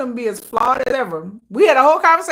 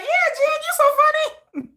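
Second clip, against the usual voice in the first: high, laughing fan praise; gushing fan. Retold or imitated. Fan laughing along at [name]'s shade, gushing. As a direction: coo at him like a smitten fan. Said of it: "You be like".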